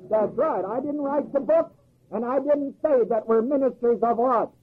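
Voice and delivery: ordinary delivery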